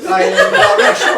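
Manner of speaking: put-on loud, high, laughing French accent on La Russian; hammed French accent. Player echoing the joke in a loud, laughing fake French accent. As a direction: push the silly French lilt with a laugh. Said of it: "with a French accent".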